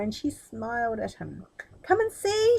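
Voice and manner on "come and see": much higher, louder excited call; excited cry. A narrator's reading. Princess calling Aladdin from the chest, excited. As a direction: bubble over with joy. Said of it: "she cried".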